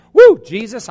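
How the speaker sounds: loud, high worship shout; exuberant whoop. Acted. Worshipper crying out to Jesus in spirit, exuberant. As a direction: burst out whooping in mock praise.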